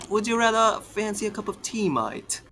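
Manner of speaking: put-on playful British accent offering a cuppa; mock British accent. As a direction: offer tea like a jokey English bloke. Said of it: "Both of our accents are not very".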